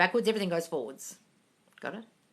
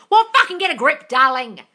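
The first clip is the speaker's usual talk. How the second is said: loud, higher yell; loud tough-love yell; 5 semitones higher, 10 dB louder than usual. Real, not acted. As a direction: shout them out of it. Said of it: "I'm yelling at you".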